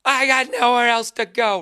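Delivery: high, loud, dramatic movie plea; officer and a gentleman quote. Retold or imitated. Quoting the movie at the bar, dramatic plea. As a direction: plead it like the film's desperate cadet. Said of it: "like an officer and a gentleman".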